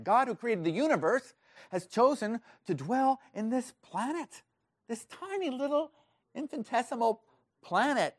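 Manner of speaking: excitement building into high-pitched wonder; excited amazement. Acted. Preacher voicing the amazed heavenly speaker to his congregation, excited. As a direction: climb high as if astounded by the news. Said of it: "Whoever's saying this is some excited".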